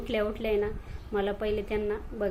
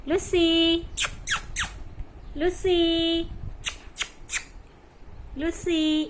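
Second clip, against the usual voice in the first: calling the kitten; 7 semitones higher, much slower than usual. Real, not acted. Owner calling her kitten Lucy to come, high coaxing call. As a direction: coax the kitten over with a high, drawn-out call.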